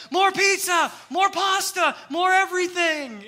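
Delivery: high frantic shout over the rush; panicked shouting. Retold or imitated. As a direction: yell orders in a kitchen panic. Panicked workers shouting orders.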